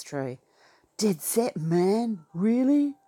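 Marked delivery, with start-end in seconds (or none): 2.3-2.9 surprised. Pretend. Mock surprised reply to slang.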